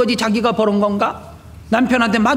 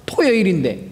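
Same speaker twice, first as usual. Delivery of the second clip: normal talking voice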